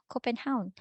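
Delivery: Copenhagen as Copen-HOWN; V pronounced as U. Shown as a demonstration. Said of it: "it's pronounced as U".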